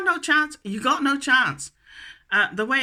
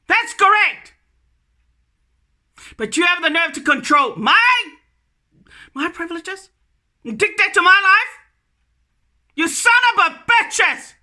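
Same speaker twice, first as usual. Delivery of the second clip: angry shout; 4 semitones higher than usual. Real, not acted. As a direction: hurl it at them. Shouting abuse at government.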